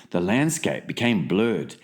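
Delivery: usual speaking voice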